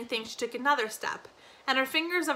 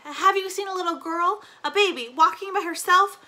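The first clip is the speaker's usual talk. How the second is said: raised, urgent plea for information; frantic questioning; 7 semitones higher, 6 dB louder than usual. A narrator's reading. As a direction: ask high and loud after a lost child. Asking stranger about lost child.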